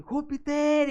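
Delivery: high, loud shout of the name; calling the dog. Retold or imitated. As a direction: yell the dog's name in alarm. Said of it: "y yo voy". (grito alarmado llamando al perro)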